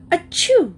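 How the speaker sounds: high, loud mock sneeze; spoken-out "achoo", loudly. A narrator's reading. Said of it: "Anna said loudly".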